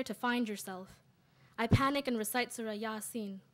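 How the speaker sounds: measured, deliberate poem reading; poetry recital. Acted. Sister's poem for brother in Cairo, measured recital. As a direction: recite it in weighted phrases with pauses.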